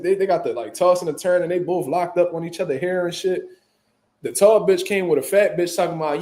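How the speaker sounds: ordinary delivery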